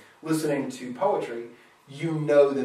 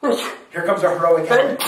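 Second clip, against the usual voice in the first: louder, quick, thrilled aside; mock excitement; 3 semitones higher, 8 dB louder, faster than usual. Listener recognizing the meter of an epic, mock excitement. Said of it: "you almost go".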